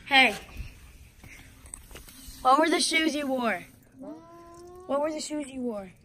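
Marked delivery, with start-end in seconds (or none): 0.1-3.6 loud, slow call across the house; slow shout to someone far off. Real, not acted. Calling to brother in house.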